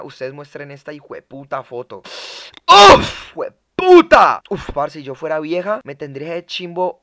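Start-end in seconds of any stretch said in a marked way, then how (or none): 2.7-4.4 explosive high shout, second word drawn out; impressed outburst. Real, not acted. Admiring a photo for the viewers, an explosive impressed shout. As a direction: shout it out, bowled over. Exclaims over a photo's beauty.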